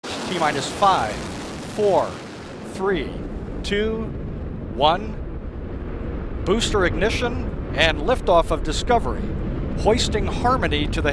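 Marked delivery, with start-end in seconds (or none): none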